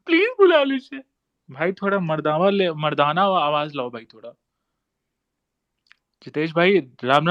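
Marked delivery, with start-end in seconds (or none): 0.1-1.0 squeaky high mock girl voice; hammed-up imitation of a pleading girl; mocking a girly voice. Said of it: "लड़की वाला आवाज". (लड़की की आवाज़ की नकल)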